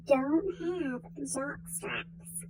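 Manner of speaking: slow, scandalized whisper; hushed whisper. Acted. Telling viewers a scandalous rugby fact, slow hushed whisper. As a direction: drop to a secret whisper.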